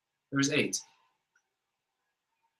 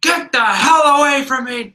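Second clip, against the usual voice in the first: high, loud mock-furious yell; exploding in anger; 11 semitones higher, 5 dB louder than usual. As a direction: imitate patience finally snapping. Gene finally blows up at Jimmy.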